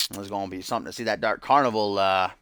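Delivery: usual speaking voice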